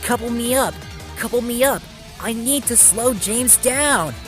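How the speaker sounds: high, insistent call; urgent calling out. A narrator's reading. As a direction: call orders to the guard. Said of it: "He then called out".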